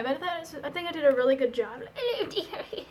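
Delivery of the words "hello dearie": high granny voice, laughing through it; giggly sweet old lady greeting; elderly singsong. Acted.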